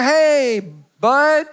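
high, loud friendly hello; hearty greeting. Retold or imitated. Pastor greets the drunk stranger, high and bright. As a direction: greet him like an old buddy. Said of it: "I said".

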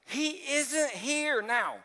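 very slow, high proclamation; slow high proclamation of the empty tomb; dramatic emphasis. Acted. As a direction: draw out He isn't here now slowly and high. Reading the climax line.

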